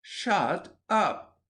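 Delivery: high, drawn-out "shut up"; each word punched high and separate; irate retort. Acted. Irate boy cuts off his friend, angry retort.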